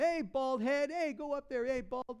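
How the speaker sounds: high-pitched heckling; teasing taunt. Acted. Boys heckling the bald prophet, mocking. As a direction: call out mockingly like boys picking on someone. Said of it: "they're making fun of him".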